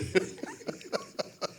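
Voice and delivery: squealing airy laugh; amused high laugh. Real, not acted. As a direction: burst out laughing at the joke. Laughs after church joke.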